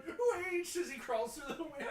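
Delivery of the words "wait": put-on high, louder old man call; Bingo voice. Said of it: "Bingo, we hear from behind going".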